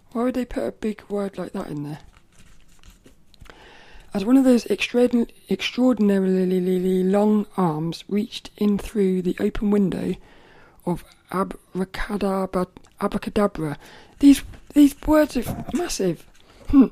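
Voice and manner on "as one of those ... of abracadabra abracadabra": storybook reading. Acted. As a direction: read it out like to a room of kids. Reads storybook aloud.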